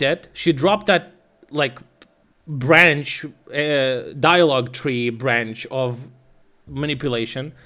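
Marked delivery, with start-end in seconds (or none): none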